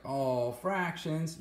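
high, slow mock groan of dread; groaning student. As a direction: drag it out like a student dreading fractions. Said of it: "I know you guys are like".

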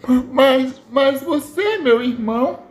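high, stammering disbelief; shocked. Acted. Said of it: "ela fica toda chocada".

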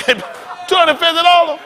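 loud, high outraged cry; outraged shout. Real, not acted. Outraged at bra price.

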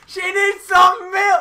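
slow, high pained yell; pained shout. Real, not acted. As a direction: shout for help in pain. Mouth burning, begging for milk.

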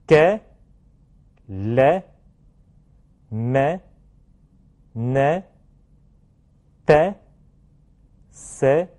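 给, 来, 没 as French syllables with a spread open è; French word drill. Shown as a demonstration. Teacher has class repeat, même and elle with clear French è. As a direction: model même, scène, elle one by one with open è. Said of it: "下面放到单词里面".

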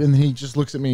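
ordinary delivery

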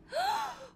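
very high, sharp gasp; squeaky intake of breath; startled gasp. Real, not acted. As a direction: gasp in alarm. Startled by creature in game.

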